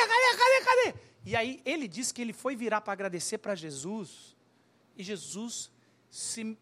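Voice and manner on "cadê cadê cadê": anxious searching call. Acted. Man searching for Jesus in crowd.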